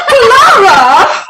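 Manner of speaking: calling out her name, high and loud through laughter; laughing call. Acted. Mother to daughter, a loud laughing call. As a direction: shout her name while giggling.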